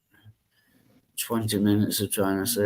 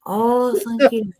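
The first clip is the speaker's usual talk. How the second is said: high, delighted oh thank you; delighted surprise; 10 semitones higher, 4 dB louder than usual. Real, not acted. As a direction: squeal with flattered delight. Flattered by compliment.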